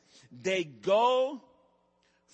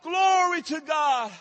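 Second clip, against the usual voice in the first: shouting, rising higher and louder; preaching at full force; 10 semitones higher, 9 dB louder than usual. Real, not acted. Pastor shouting strength over the congregation, fired up. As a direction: preach it out at the top of your voice. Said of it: "Okay, I'm getting excited".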